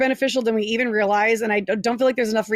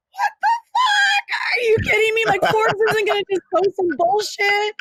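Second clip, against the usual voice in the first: exasperated shout; 11 semitones higher than usual. Retold or imitated. Mom retelling her reply to skeptical husband, outraged shout. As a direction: yell it in outraged disbelief. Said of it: "I'm like".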